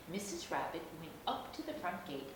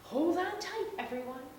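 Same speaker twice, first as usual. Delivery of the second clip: much higher, louder excited call; crying out; 13 semitones higher, 7 dB louder than usual. Acted. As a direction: call out to everyone to brace. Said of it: "she cried".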